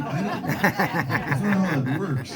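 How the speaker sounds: slow, laughing through the words; laughing disbelief. Retold or imitated. Musician laughing off a drunk fan's request. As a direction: chuckle it out in disbelief.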